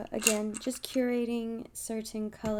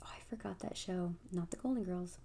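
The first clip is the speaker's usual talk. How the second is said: whispered aside; 9 dB quieter, faster than usual. Real, not acted. Aside while forgetting show name.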